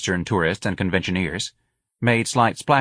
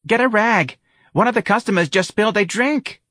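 loud, high voice across the bar; calling out; 4 dB louder than usual. A narrator's reading. Missus Riley calls across the bar to the bartender, loud. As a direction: keep calling for a rag.

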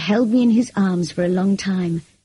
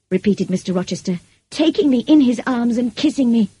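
usual speaking voice

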